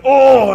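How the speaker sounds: sharp exasperated Oh, high and loud; frustrated oh. Retold or imitated. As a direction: blurt it out like a fed-up dad. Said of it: "And I'm like".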